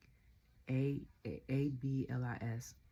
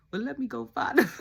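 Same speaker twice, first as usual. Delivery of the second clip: high, louder, laughing through the words; laughing quote; 8 semitones higher, 8 dB louder than usual. Retold or imitated. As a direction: laugh while quoting him. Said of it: "He gonna say".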